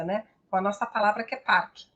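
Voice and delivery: ordinary talk, nothing marked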